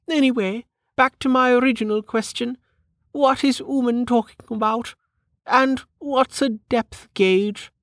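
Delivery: high-pitched aristocratic lady; high, mannered noblewoman asking; inquiring noblewoman. Acted. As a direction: question them in a high lady's voice. Narrator voices Lady Parr.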